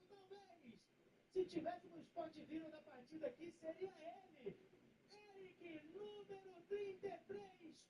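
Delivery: slow, emphatic goal celebration; explosive goal commentary. Acted. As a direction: call the goal like a radio announcer.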